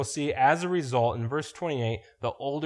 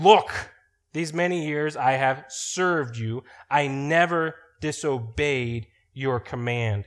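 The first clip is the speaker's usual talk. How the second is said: angry son; 2 semitones higher, 4 dB louder than usual. Shown as a demonstration. Older son snapping at his father over the party, angry. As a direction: bark out Look and drag the rest with grievance. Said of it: "He is mad at his father".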